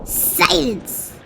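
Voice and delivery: sharp royal bark. A narrator's reading. King Yertle barking down at Mac to be silent. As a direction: snap the word like a tyrant king. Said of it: "barked back".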